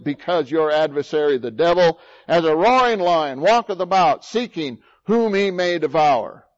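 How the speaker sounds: preacher's loud warning about the roaring lion; forceful preaching. Acted. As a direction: sound the alarm like a watchman.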